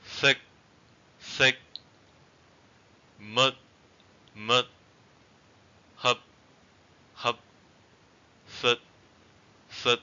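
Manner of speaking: "Thick", "mud", "hup", "sit" short, each cut off at the stop; short vowels. Shown as a demonstration. Teacher repeating eighth-tone words for learners, short vowels. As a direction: say each short, on a level tone, unreleased. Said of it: "let's repeat it one more time".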